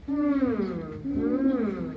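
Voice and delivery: loud, drawn-out pondering hum; thoughtful hum. Shown as a demonstration. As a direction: hum like stroking a beard. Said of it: "Can you say that with me?".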